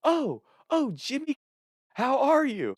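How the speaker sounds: very high, sing-out grandma greeting; hammed-up cheerful old lady on the phone. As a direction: imitate a delighted grandma, way up high. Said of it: "all cheerful".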